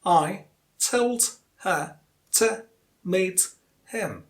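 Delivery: slow, each word separate with a pause. Shown as a demonstration. Teacher lays out the words for learners, word by word. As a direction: count out the sentence one word at a time. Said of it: "Let's look at the words here".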